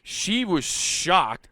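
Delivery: usual speaking voice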